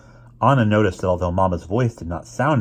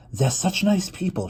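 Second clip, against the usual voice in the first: Mama voiced; 11 semitones higher than usual.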